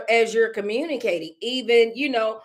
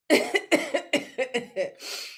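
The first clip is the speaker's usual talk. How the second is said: a real coughing fit, still choking; 3 semitones higher, 4 dB quieter than usual. Real, not acted. Streamer coughing after choking on saliva, real coughing fit. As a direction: hack and clear the throat after choking.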